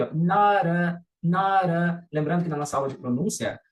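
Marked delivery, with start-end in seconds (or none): 0.1-2.0 "not a" joined, sounding like "nara"; linked flapped T. Shown as a demonstration. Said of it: "e depois eu juntei". ("not a" emendado, T com som de R)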